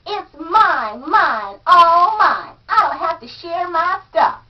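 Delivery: put-on shouting like a spoiled boy; tantrum shout. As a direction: throw a spoiled child's tantrum. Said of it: "throw temper tantrums and shout".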